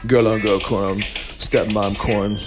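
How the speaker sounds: normal talking voice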